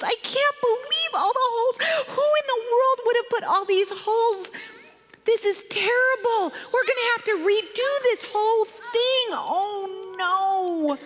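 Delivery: high-pitched, exasperated ranting; mad father. Retold or imitated. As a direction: rant in dismay, ending on a long wail. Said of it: "she saw that he was so mad".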